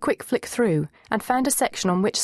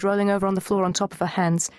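usual speaking voice

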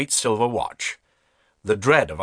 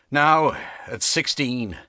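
plain reading voice

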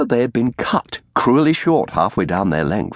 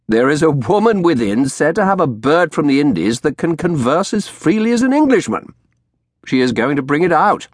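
lively, higher-pitched gossip; amused lawyer; 8 semitones higher than usual. A narrator's reading. Pepper laughed, explains the spectacle.

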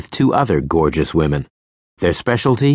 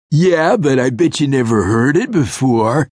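higher, pleased with himself; smug sleazy voice; 7 semitones higher than usual. A narrator's reading. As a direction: gloat like a cheesy charmer. Sleazy guy proud.

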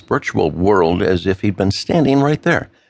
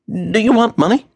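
anxious man's voice; 12 semitones higher than usual. Acted. Matt's voice, anxious question.

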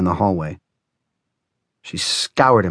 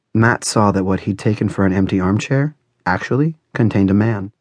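usual speaking voice

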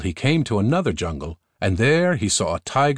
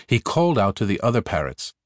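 ordinary delivery, nothing marked

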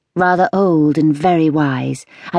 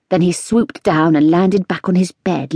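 plain reading voice

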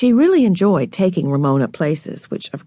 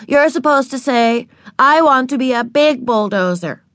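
put-on higher, insistent little-kid correction; 5 semitones higher than usual. A narrator's reading. Little sister corrects her older sister's reading, insistent. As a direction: correct her firmly, pitched up. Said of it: "insisted Ramona".

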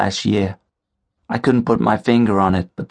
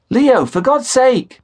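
much higher, loud, slow exasperated cry; exasperated yell into the phone; swearing; 4 dB louder, slower than usual. Acted. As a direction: blow up in frustration. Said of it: "I swore".

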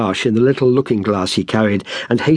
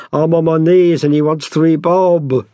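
higher-pitched wail of protest to heaven; theatrical pleading; 7 semitones higher, 4 dB louder than usual. A narrator's reading. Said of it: "Saul raised his hands to heaven".

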